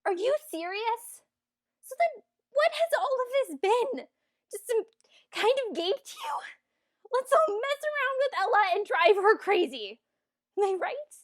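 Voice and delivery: high-pitched hurt disbelief; indignant accusation. Acted. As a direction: confront him, feeling played with. Hurt, angry confrontation with prince.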